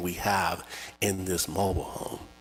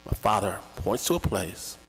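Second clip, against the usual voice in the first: normal talking voice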